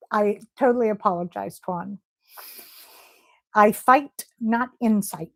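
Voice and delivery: ordinary talk, nothing marked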